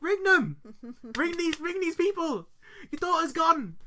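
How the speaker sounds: high, loud, urgent pleading; exasperated yelling. Acted. Host urging parents to report missing daughter, exasperated yell. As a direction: yell it at someone hopelessly oblivious.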